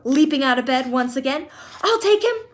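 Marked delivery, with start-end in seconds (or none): 1.8-2.4 high, loud and insistent; eager shout. Acted. Grandpa Joe insisting he takes Charlie, high loud shout. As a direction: claim the job with joy. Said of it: "shouted Grandpa Joe".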